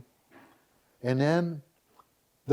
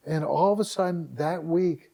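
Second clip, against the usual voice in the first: normal talking voice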